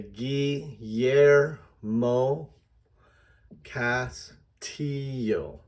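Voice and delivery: "Guillermo Castillo" slowly sounded out as gee-yer-mo, cas-tee-yo; Spanish, syllable by syllable. Shown as a demonstration. Teacher breaks down a Spanish name, slow syllable-by-syllable delivery. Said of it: "Here's how I would do it".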